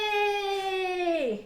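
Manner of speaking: high, long drawn-out cheer; real happy cheer, not shouted; celebrating. Real, not acted. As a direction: squeal it out in delight. Celebrates the stun landing.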